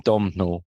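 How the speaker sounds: Domhnall said as "DOM-nal", with an audible m; mispronounced name. Shown as a demonstration. Owning up to the wrong name, mispronunciation. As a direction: say Domhnall the mistaken way. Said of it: "I kept calling him".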